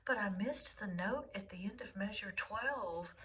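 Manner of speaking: whispered self-doubt; the voice in your head, whispered fretting; inner voice whisper. Retold or imitated. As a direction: whisper it like a nagging thought. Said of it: "the voice in your head going".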